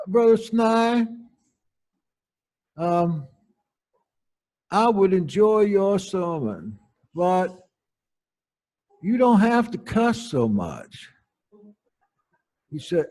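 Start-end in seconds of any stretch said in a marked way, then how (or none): 0.1-11.1 lightly voiced older lady, a bit higher and slow; a hint of a prim old churchwoman scolding gently; prim church matriarch. Matriarch scolding the preacher, lightly voiced prim older lady.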